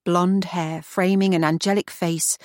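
ordinary delivery

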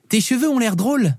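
put-on high-pitched child's remark; little girl. Daughter commenting on dad's hair, high child voice. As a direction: say it like a small daughter.

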